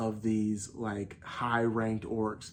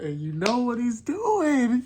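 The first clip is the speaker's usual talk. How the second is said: high, louder and slower, building hype; excited; 12 semitones higher, 6 dB louder, slower than usual. Real, not acted. Fan hyping the summoning to viewers, excited and high. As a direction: tease the big reveal, voice rising.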